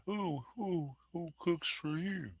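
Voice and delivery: very high, soft hooting owl call; breathy little hoot like a distant owl; barred owl call. Retold or imitated. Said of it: "Like that".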